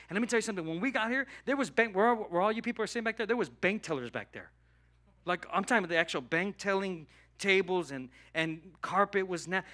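usual speaking voice

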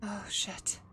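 hushed scared curse; real whispered curse. Real, not acted. Creeping through scary game.

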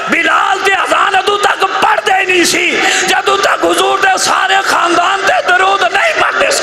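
high, ringing preacher's declamation; insistent sermon build, pitched high; emphatic preaching. Real, not acted. Preacher rises into emphatic rhetoric.

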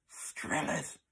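soft hissed correction; girl correcting Tom under her breath. Acted. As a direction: slip the right name in quietly. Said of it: "hissed Amber".

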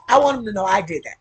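loud, quick, laughing declaration; loud and unapologetic, amused; laughing boast. Real, not acted. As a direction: burst out with it proudly after a laugh. Laughs, proudly repeats her admission.

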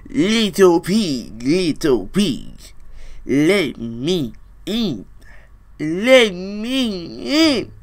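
slow drawn-out big bad wolf plea; slow wolf calling through the door. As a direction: coax the pig from outside the door, slowly.

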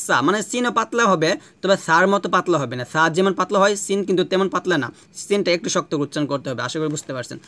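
ordinary talk, nothing marked

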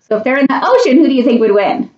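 ordinary delivery

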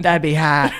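laughing imitation of botched Boston accent; teasing Boston-accent echo; mock Boston accent. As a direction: mimic a bad Boston accent through laughter. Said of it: "That was Boston".

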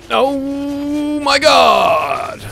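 long, drawn-out high shout; alarmed cry. Real, not acted. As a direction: wail it out in alarm, stretching every word. Player panics under attack.

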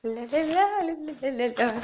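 slow la-la tongue exercise; slow tongue warm-up. Shown as a demonstration. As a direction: loosen the tongue, slow syllables. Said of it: "у меня язык заплетается".

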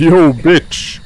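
high loud taunt; yelling taunt. Acted. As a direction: yell a taunt.

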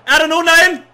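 loud, high calls rising to a wail; excited shouting, building. Real, not acted. Gamer finding nobody in the box, shouting in despair. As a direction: start shouting plays, build to an anguished yell. Match action builds to outburst.

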